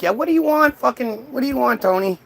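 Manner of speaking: high, snappy annoyed boss; mock irritated God. God snapping at Paul on the phone, high and annoyed. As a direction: bark at the useless employee. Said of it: "God's like".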